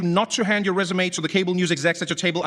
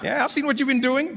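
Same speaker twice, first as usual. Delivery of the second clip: ordinary delivery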